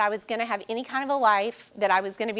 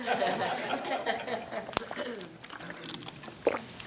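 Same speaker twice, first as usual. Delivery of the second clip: teary laugh; 9 dB quieter than usual. Real, not acted. Laughs through tears on stage.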